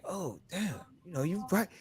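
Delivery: acted-out soft, dawning realization; quietly retold moment of being struck; hushed realization. Retelling his reaction to friend's insight.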